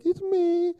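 imitating a boy in a high falsetto; squeaky-high kid impression; mimicking young son. Hiding son answers dad from the alley, mimicked high child voice. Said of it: "And Toby was like".

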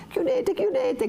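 high, drawn-out frightened cry. Acted. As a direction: call out to have the door shut. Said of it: "gridava Lucia". (Lucia grida di chiudere, acuta e lenta)

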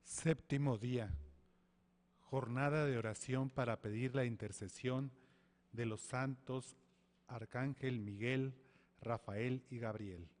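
prayer leader addressing the Lord; leading a prayer. Acted. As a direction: announce the day and pray out loud to the congregation. Leads a louder opening prayer.

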